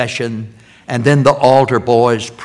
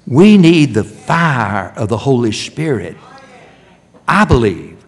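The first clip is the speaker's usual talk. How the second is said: normal talking voice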